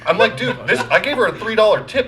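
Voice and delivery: high-pitched retold rant; losing his mind. Customer ranting at store over sandwich, high exasperated complaint. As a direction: recreate an exasperated outburst, voice climbing. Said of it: "I was losing my mind".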